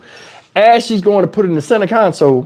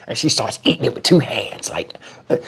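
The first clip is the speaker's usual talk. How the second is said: whisper; 6 semitones lower, 6 dB quieter than usual. Real, not acted. Aside while telling story.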